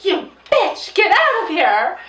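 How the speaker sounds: telenovela mom. Retold or imitated. Mom slapping her son's ex in a telenovela-style showdown. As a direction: spit it out like a soap-opera mother.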